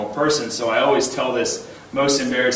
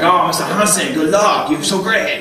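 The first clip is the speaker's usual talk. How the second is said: higher, louder gushing fan voice; adoring fans; 6 semitones higher, 4 dB louder than usual. Retold or imitated. Swimmer imitates Japanese fans gushing over him. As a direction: gush like an admiring fan.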